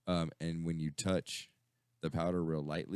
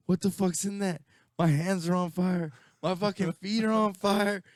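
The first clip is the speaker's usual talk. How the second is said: higher, louder panicked yell of a friend whose hands burn; 10 dB louder than usual. Retold or imitated. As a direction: shout it like your hands and feet are on fire. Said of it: "and he's like".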